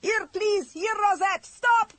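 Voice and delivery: high, loud desperate yelling; frantic yell. A narrator's reading. Terrified driver yelling at William to stop. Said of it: "the driver yelled".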